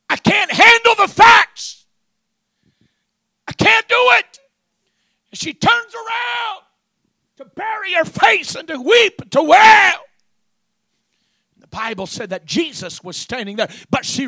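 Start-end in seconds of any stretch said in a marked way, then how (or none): 0.1-4.4 loud, high cry of despair. Acted. Mary unable to face the facts, despairing shout. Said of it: "despair, grief". 5.3-10.0 high, loud pulpit cry; preaching at full force. Acted. Preacher telling of Mary weeping, full-force preaching.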